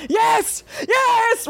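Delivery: squealing high "yes, yes"; retold excitement. Reliving her reaction to the twist, high excited squeal. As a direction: cheer it in a high, thrilled voice.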